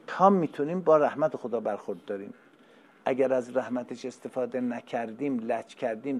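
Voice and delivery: normal talking voice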